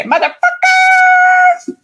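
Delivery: "motherfucker" squealed very high and stretched; high drawn-out squeal. Shown as a demonstration. Host showing how the phrase must be said, high drawn-out squeal. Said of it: "You gotta say".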